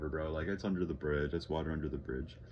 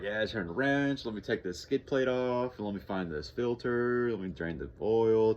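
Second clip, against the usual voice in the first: higher-pitched replay of himself on a roll; acted confidence; 5 semitones higher, 4 dB louder than usual. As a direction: rattle off the steps, pleased with yourself. Acts out his confident self working.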